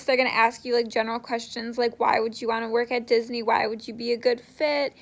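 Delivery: usual speaking voice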